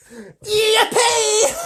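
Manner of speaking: very high, loud gleeful whoop; shouted. Acted. Grandpa Joe dancing with Charlie, very high gleeful whoop. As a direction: whoop with pure joy. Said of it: "shouted Grandpa Joe".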